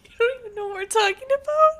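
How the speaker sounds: shocked, laughing disbelief; incredulous. Real, not acted. Incredulous shout amid laughter.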